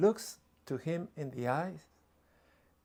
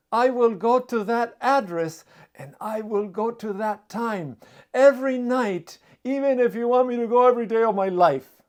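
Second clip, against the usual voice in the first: louder, higher, rushing at the end; eager lovestruck promise; 6 semitones higher, 8 dB louder than usual. Retold or imitated. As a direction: gush it out like a smitten suitor. Smitten man agrees eagerly.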